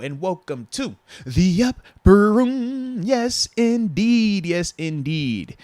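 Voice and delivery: loud, high, drawn-out announcer hype; hyped welcome. Real, not acted. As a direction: welcome a new member with big stretched-out fanfare. Hyped welcome to new member.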